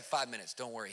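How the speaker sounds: a calm pastor shrugging off the chaos; cool and collected. Retold or imitated. Pastor calmly reassuring the shocked visitor, low and soft. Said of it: "He's just cool and collected".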